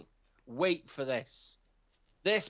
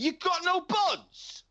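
very high, slow, louder disbelief; 12 semitones higher, 4 dB louder, slower than usual. Retold or imitated. Hearing there are no buns, a very high slow squeal. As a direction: squeal the question in shock. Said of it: "I was like".

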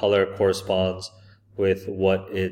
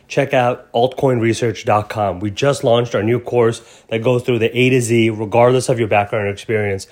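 ad read; 3 semitones higher, 7 dB louder than usual. Acted. Recorded sponsor ad read.

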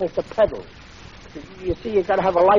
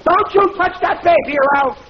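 furious threat; 9 semitones higher than usual. Acted. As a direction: warn him off the baby at full pitch. Quarrel with father-in-law over baby.

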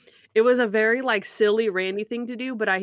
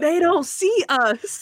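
hammed-up hobo; 8 semitones higher, 4 dB louder, slower than usual. Hobo voice retold, slow.

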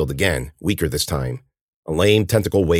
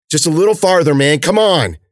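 high, loud encouragement; urgent urging; 10 semitones higher, 5 dB louder than usual. Acted. Cece urging winded Ben onward, loud and urgent. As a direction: drive him on without letting up.

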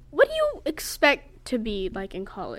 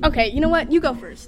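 louder, raised, exasperated handover; 5 semitones higher, 10 dB louder than usual. Real, not acted. Host to laughing friend who mocks her, exasperated. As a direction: cut off the teasing, loudly.

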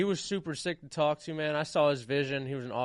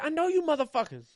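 much higher, playful recognition; mock comic; 11 semitones higher than usual. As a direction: tease them warmly.

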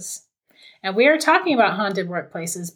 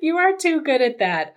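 laughing praise; 9 semitones higher than usual. Real, not acted. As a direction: gush through laughter.

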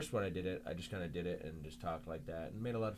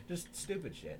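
ordinary talk, nothing marked

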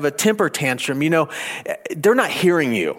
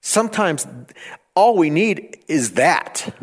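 ordinary talk, nothing marked

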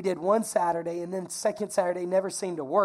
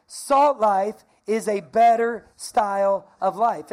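slow emphatic call; 7 dB louder, slower than usual. Shown as a demonstration. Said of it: "Say it with me".

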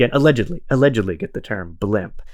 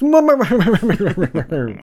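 high, hammed-up pompous chuckle; pompous British laugh; 8 semitones higher, 4 dB louder than usual. Host showing off his pompous British laugh, high and hammy. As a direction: laugh like a posh officer. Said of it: "very pompous, uh, British laugh".

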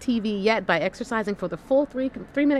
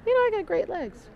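much higher, pleased with herself; proud mirror self-talk; 12 semitones higher than usual. Retold or imitated. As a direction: chirp it to yourself in the mirror. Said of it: "you should say to yourself".

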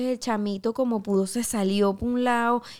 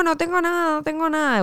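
mock frightened plea; 8 semitones higher than usual. Denying to the young thief she has more gold: high scared plea. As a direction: fake innocence to the thief, pitch way up. Pleading with the robber.

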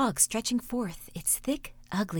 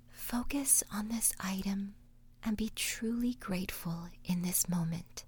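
whispered meditation; 8 dB quieter than usual. Acted. Meditation host guiding listeners: soft whisper. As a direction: whisper them gently through the exercise.

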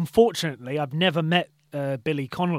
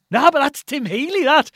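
high and loud, amazed; starstruck excitement; 9 semitones higher, 7 dB louder than usual. Retold or imitated. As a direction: gush about meeting a hero. Awed reaction to meeting Tim Healy.

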